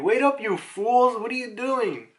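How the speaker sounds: high, drawn-out mock protest; exasperated protest. Voicing Saturn halting his Marines.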